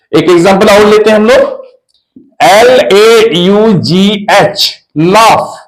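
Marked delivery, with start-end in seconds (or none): none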